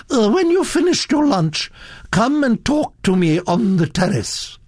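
high, imperious summons; lofty grande dame command; Duchess giving orders. A narrator's reading. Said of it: "ordered the Duchess".

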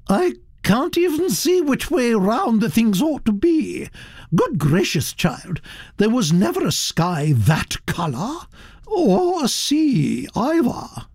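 high, swooping, scornful Duchess; frankly unsympathetic. Acted. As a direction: dismiss the artwork as a baffled grand lady. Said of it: "The Duchess was, frankly, unsympathetic".

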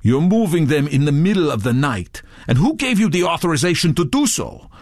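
accusing. A narrator's reading. Redbeard questioning Touré's authority, accusing. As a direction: press him with mild accusation.

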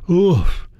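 high, falling grunt of pain; pained "oof". A narrator's reading. Hurt workmate reacting to a blow, pained grunt. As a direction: let out a high little "oof". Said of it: "I can tell he's in pain".

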